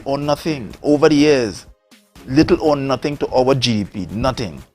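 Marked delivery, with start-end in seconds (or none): none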